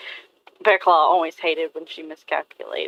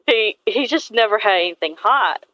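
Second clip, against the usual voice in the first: ordinary talk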